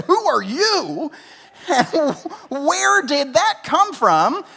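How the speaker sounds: high, slow, amused disbelief with a laugh; mock a friend who can't believe his ears; startled friend. Friend startled by sudden accent.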